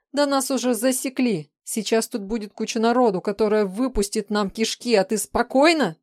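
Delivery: high, loud, panicked tirade; outraged yell. A narrator's reading. Woman berating the man as capture looms, yelling. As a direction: yell the danger at him, peaking on the last word. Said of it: "проорала тень".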